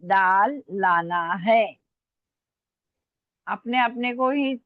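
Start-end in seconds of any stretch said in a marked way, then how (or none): 0.0-1.6 drawn-out, separated words; slow dictation. Real, not acted. Teacher repeating the sentence after spelling it, slow dictation. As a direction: read it back slowly. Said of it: "मैं आराम आराम से बोल रही हूँ".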